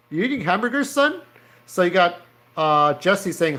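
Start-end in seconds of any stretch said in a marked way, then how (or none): 0.1-1.2 put-on high-pitched cop grilling a kid; high mock interrogation, rising on the end; mock officer. Officer questioning kids in comic.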